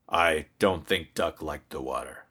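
lightly voiced low dad; gentle dad. Daddy answers worried child.

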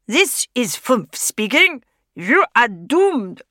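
slow, doom-laden radio villain; slow sinister villain. Acted. Intruder repeating the radio villain, slow and menacing. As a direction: drag out the threat like a wireless baddie.

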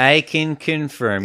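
slow, drawn-out mock Chicago accent. Agreeing with the co-host about Midwest accents, in a mock Chicago accent. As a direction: lean into a playful Chicago accent, slowly. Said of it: "is that a Chicago accent?".

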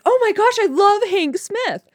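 high, drawn-out starstruck gush; breathless high fan gush. Retold or imitated. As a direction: squeal it like a fan meeting her idol. Fangirling on meeting a favorite speaker.

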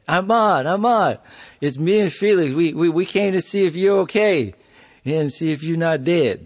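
loud, high call through the door. Retold or imitated. Calling through the front door to check on Ahmad, loud and high. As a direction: raise the voice to reach whoever is inside.

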